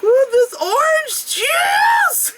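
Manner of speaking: high, slow laughing cry of relief; laughing delight. Retold or imitated. Parched after the climb, high slow laughing delight. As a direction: savor it with a laugh. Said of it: "so it's just like".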